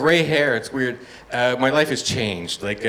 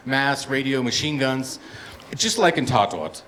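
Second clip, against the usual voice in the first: ordinary talk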